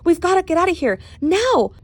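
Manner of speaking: high, loud panicked urging; urgent alarm. Acted. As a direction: get him moving at once. Urging escape.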